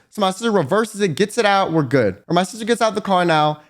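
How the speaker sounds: usual speaking voice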